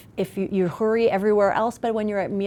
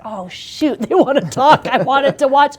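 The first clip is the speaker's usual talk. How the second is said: higher, louder mock exasperation with a laugh; mock annoyed parent; 8 semitones higher, 11 dB louder than usual. Parent groaning that the kid wants to talk, loud mock annoyance. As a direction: ham up the put-upon parent who wants Netflix.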